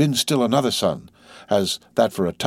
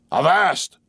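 stern shout; 6 semitones higher than usual. A narrator's reading. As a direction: halt the men sharply. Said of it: "cried Ahab".

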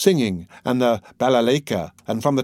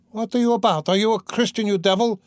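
put-on high-pitched, indignant old man; shouted; 9 semitones higher than usual. A narrator's reading. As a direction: scold from the crowd in a raised voice. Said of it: "shouted an old man in the crowd".

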